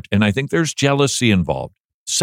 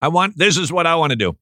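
ordinary delivery

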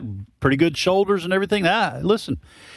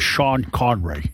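slow, put-on Scottish actor's accent; Sean Connery; 3 semitones lower, slower than usual. Co-host clowning for the other hosts, slow Scottish accent impression. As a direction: impersonate a famous Scottish film star. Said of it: "I'm just going all Sean Connery".

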